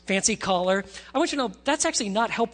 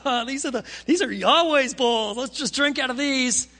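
loud, hammed-up party mockery; mocking revelers; 5 semitones higher, 4 dB louder than usual. Drunken partygoers at the king's feast mocking God, jeering. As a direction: play a raised-pitch, jeering reveler. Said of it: "they're mocking God like".

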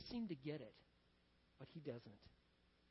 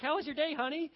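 higher, louder wife voice; wife's greeting; 6 semitones higher, 4 dB louder than usual. Acted. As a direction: play the wife greeting her husband. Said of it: "She's like".

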